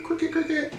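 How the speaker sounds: high, soft chirped cricket noise; cricket chirps. Acted. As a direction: mimic crickets chirping in an awkward silence. Awkward silence, mimics crickets.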